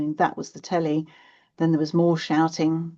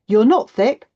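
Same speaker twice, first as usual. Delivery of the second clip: louder, higher protest; firm motherly rebuttal; 6 semitones higher, 9 dB louder than usual. A narrator's reading. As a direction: mum insisting, emphatically. Mum firmly contradicts the insult.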